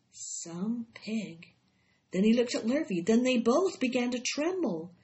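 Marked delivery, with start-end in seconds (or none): 0.1-1.5 soft, understated murmur. A narrator's reading. Zuckerman reads the writing on the web, murmured. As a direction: say it softly, as a murmur. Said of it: "Then he murmured the words".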